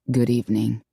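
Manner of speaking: low, reluctant greeting; grumbling man. A narrator's reading. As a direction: mutter it grudgingly. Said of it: "He grumbled".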